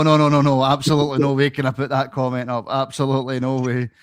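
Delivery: normal talking voice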